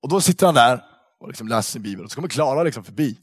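normal talking voice

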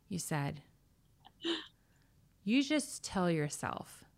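low, soft and steady; calm. Retold or imitated. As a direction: calmly reassure a friend. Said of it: "Very calmly".

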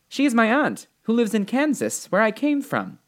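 put-on high-pitched, earnest little girl; young Dorothy. Dorothy tells the witch what her aunt said, earnestly.

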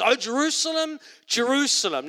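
high, ringing call to the city; Jesus crying out. Acted. Jesus calling out to Jerusalem, high and ringing. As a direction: call out over the city with longing.

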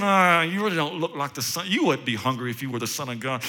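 mocking devil. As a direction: needle him quietly with contempt. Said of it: "he's saying".

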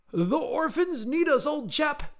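very high, loud, urgent cry; frantic Englishman. A narrator's reading. Eccentric Englishman summoning his friend to help orphans, frantic.

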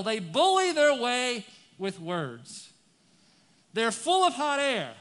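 loud, high, impassioned scripture reading; preaching at full force. Acted. Preacher reads the psalm loud to the church. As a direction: thunder out the psalm to the congregation.

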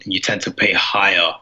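ordinary delivery

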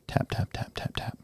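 whispered tap tap, very soft, imitating a timid knock; soft knock sound. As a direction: breathe the taps out almost silently. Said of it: "there's a very soft knock on the door".

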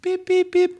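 very high beeping call tone; phone beep imitation. Imitating a phone line beeping, very high. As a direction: mimic a call-waiting beep.

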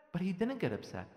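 normal talking voice

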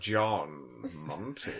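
slow, low hammy Vincent Price. Pretend. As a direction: drawl it like a horror host. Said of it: "what's your Tom Monty voice".

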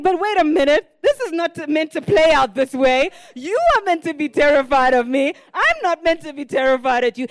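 a hint of a startled small dog protesting; imagined dog's voice. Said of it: "'Cause it's saying".

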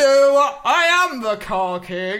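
grand car king. Acted. The car king greets the clerk, grandly. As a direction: announce yourself as royalty.

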